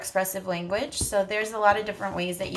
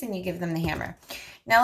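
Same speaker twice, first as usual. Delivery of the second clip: ordinary delivery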